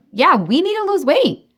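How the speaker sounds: acted-out inner prompt; inner gut voice. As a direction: pipe up as a nagging gut feeling. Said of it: "if you have a whisper".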